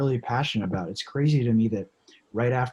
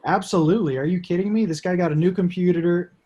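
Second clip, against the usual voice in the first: excited; 6 semitones higher, 5 dB louder than usual. Real, not acted. Enthusiastic praise for the hosts.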